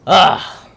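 loud, high groan of frustration; exasperated ugh. Real, not acted. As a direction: burst out with a fed-up "ugh". Frustrated with game enemies.

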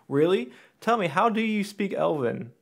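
high, loud incredulous comeback; mock challenge. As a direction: challenge the pedant with exaggerated disbelief. Acts out challenge to a pedantic critic.

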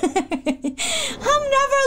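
slow, high laughing wail; half laughing, half crying in defeat; drawn-out laughing sob. Real, not acted. Overwhelmed after dying in game.

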